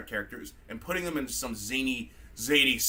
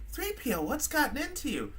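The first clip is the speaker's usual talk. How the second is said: soft, high-pitched concerned woman; lightly puzzled, high and quiet; Princess Leia; 9 semitones higher, 5 dB quieter than usual. Acted. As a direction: ask in a high, softer feminine voice. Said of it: "Leia comes up, she's all like".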